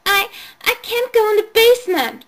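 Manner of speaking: put-on high, stammering little girl; scared child. A narrator's reading. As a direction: call out, frightened and small. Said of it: "Chloe called".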